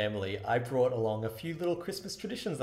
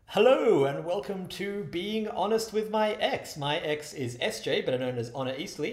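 bright, slow show opener; podcast host intro; 7 semitones higher, 4 dB louder, slower than usual. Acted. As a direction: welcome listeners like a host.

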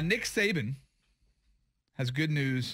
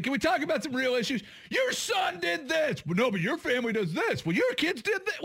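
high-pitched mock squabbling; mock debate shouting; 12 semitones higher than usual. Host mimicking debaters trading accusations, mock shouting. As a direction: imitate candidates yelling accusations, pitched way up. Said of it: "all the things that we were shouting".

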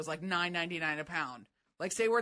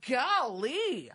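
higher, drawn-up mimicry of his golly; imitating correspondent's golly; 5 semitones higher, 4 dB louder than usual. Cohost quoting the correspondent's favorite word, exaggerated golly. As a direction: mimic his emphatic two-beat "Golly". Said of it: "says and uses the word frequently".

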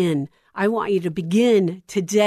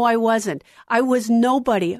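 normal talking voice

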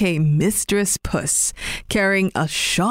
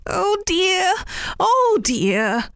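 high, wailing oh dear; dismayed cry; 12 semitones higher, slower than usual. Acted. Rabbit crying out to old man over broken knife, high wail. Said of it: "cried Mister Rabbit".

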